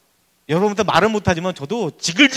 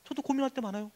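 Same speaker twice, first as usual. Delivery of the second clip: usual speaking voice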